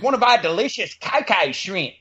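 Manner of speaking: high mock Aussie pitch, "cocoa" as "kai-kai"; imitated Australian ad guy. Mimicking the Outback commercial for co-host, high Australian accent. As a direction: sell it in a hammed-up Australian accent. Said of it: "kept calling it cocoa shrimp".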